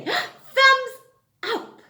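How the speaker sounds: high, loud "Thumbs" after a gasp; gasp, then pounce on the idea; sudden idea. Acted. Teacher suddenly thinking of the game, high and excited.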